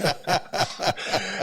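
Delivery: delighted high laugh; high burst of laughter. Real, not acted. Player recalling matching Steph's points, laughing high. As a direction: giggle up high at the memory.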